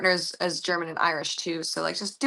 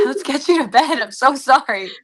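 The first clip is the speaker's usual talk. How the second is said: higher, louder playful fussing; mock-alarmed apology; 6 semitones higher, 7 dB louder than usual. Host imagining guest up at four AM, mock-alarmed apology. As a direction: fuss apologetically, half joking.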